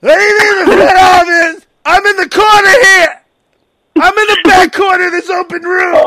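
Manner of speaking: yelling. Retold or imitated. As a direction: shout in frustration. Imitating Sam yelling.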